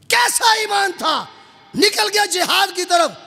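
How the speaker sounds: high, ringing, shouted admiration; impassioned preaching. Real, not acted. Preacher marvelling at a companion's faith, high and fervent. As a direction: exclaim it to the whole congregation.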